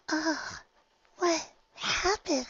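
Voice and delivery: very high, slow timid voice; dazed Fluttershy. Acted. Fluttershy getting up, high slow timid voice. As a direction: wake up confused and small.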